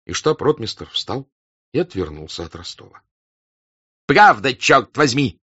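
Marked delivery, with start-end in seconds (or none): 4.1-5.4 loud, raised, drawn-out cry; excited shout. A narrator's reading. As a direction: cry out as if jumping up. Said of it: "закричал, вскакивая".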